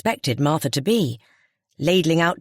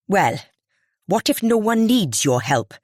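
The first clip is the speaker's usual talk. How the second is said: snapped; 5 semitones higher than usual. A narrator's reading. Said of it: "Missus Ball snapped".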